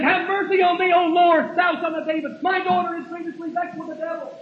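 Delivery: high, pleading call after someone; acted cry for mercy, pitched high. Desperate mother calling after Jesus in the street, crying out. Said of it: "she's crying after him".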